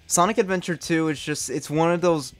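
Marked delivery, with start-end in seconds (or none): none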